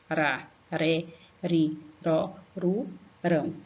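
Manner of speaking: "Ra, ré, ri, ró, ru, rão" with tapped Rs; evenly spaced "Ra, ré, ri, ró, ru, rão", no force on the R; weak R. Shown as a demonstration. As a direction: recite the syllables with a light tongue flick. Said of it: "falar ele com som fraco".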